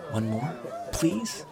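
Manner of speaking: put-on child's hopeful plea; pleading boy. A narrator's reading. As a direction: beg sweetly, pitch lifting high on please. Boy pleads for one more fight.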